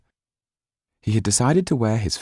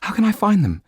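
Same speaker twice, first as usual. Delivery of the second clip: put-on high, eager girl's rush; barely contain the thrill; excited; 5 dB louder, faster than usual. Sido pressing the lawyer, excited. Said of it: "hardly able to contain her excitement".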